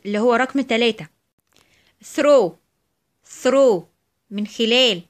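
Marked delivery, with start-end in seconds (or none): 2.1-3.8 "throw" loud and falling, breathed th; voiceless TH. Shown as a demonstration. Teacher gives examples of the rule, "throw" with voiceless th. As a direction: model "throw" twice with voiceless th. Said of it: "بتنطق في الحالة دي ث".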